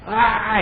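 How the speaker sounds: high sudden mock cry; mock shout. Preacher mocks worshippers shouting while reading, hammed-up cry. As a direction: blurt a churchy exclamation. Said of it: "como que le sigues".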